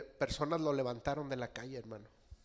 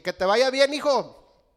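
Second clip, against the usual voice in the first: higher, loud cheerful farewell; father's send-off; 9 semitones higher, 14 dB louder, slower than usual. Retold or imitated. Father calls goodbye to his son, high and loud. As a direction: sing out a bright goodbye.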